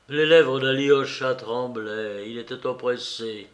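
ordinary delivery, nothing marked

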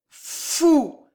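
high, drawn-out stammer; stammering drunk. Acted. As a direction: stutter it out in tipsy disbelief. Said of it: "bégaya Gillot".

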